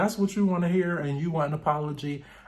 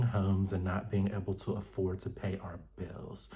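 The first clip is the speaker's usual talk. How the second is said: soft, hushed, prim and wounded; Angel voice; 3 semitones lower, 4 dB quieter than usual. Retold or imitated. Recapping the show, doing Angel's soft voice. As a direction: speak quietly like an offended lady. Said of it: "I gotta put on my Angel voice".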